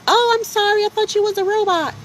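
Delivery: very high, loud falsetto apology; mock a startled customer in a squeaky voice; surprised customer. Customer apologizes to him in a high squeaky voice. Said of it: "they'd be like".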